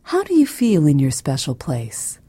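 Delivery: ordinary delivery, nothing marked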